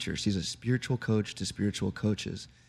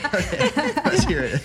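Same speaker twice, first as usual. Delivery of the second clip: high, loud laughing okay; 11 dB louder than usual. Real, not acted. Host laughing at guest's joke, loud laugh. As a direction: laugh through the words, delighted.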